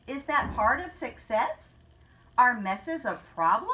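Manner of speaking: ordinary delivery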